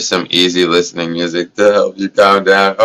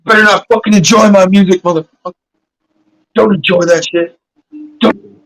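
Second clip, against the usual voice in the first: mock music owner; 11 semitones higher than usual. Streamer mocks an owner banning fans enjoying music, high hammed-up threat. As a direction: shriek the threat like a petty label boss.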